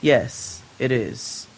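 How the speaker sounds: short, low and clipped yes; curt. Retold or imitated. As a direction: answer tersely, holding back annoyance. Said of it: "curt all of a sudden".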